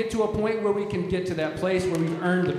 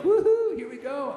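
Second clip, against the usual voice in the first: joyful calf; 7 semitones higher than usual. Retold or imitated. A calf bursting out of the gate in spring, whooping with joy. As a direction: cut loose like a calf let out of the gate. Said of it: "the calf just goes like".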